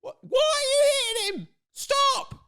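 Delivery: very high mock shriek of protest; hammed-up wailing protest; mock outraged wail. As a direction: cry out like a badly acted bystander. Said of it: "She's sitting there with her lines".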